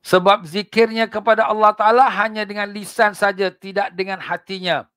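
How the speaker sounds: fiery preaching. Acted. Preacher warning the congregation about hypocrites, fiery. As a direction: drive the warning home from the pulpit.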